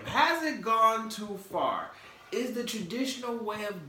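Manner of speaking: high, loud, slow showman question; hammed-up host question; dramatic question. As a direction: pose it like a talk-show cliffhanger. Host posing dramatic topic question.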